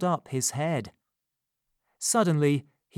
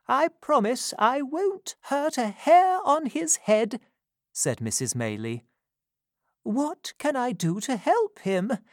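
put-on kind old lady; 10 semitones higher than usual. Mrs Maylie promising Rose to protect the boy, gentle higher voice. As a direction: soothe her niece with a promise.

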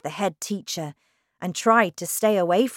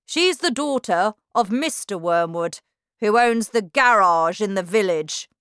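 loud, higher, domineering headmistress shout; 3 semitones higher, 7 dB louder than usual. A narrator's reading. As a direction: cut her off with a bossy yell. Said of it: "Miss Trunchbull shouted".

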